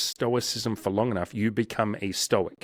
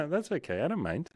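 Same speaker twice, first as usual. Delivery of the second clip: higher, softer, breezy with a laugh; light laughing reply; 6 semitones higher, 6 dB quieter than usual. Retold or imitated. Laughing off someone's apology, lightly amused. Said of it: "And you laugh and you're like".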